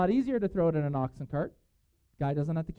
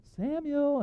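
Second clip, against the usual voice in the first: a high, drawn-out call of the name; God calling; 12 semitones higher than usual. Acted. God calling young Samuel in his bed, high drawn call. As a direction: call the boy's name from afar in the night.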